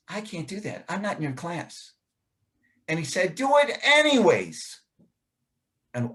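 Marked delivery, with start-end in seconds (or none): 0.1-1.9 put-on soft, hesitant boy's reply; lightly voiced shy boy, pulling back; timid refusal. Boy telling the teacher he's not in his class, softly. 3.4-4.8 higher, firm teacher's command; a touch of a stern teacher insisting; insistent teacher. Retold or imitated. Teacher ordering the boy to the board, firmly.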